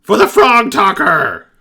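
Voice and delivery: high, loud excited goblin cheer; shouting goblins. Acted. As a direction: yell it while jumping. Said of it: "jump up and down and shout".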